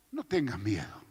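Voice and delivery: calm reassurance. Retold or imitated. Prophet calms frightened servant.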